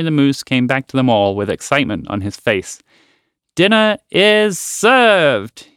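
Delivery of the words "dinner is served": higher, slow proud announcement; proud exclaim. A narrator's reading. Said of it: "he proudly exclaimed".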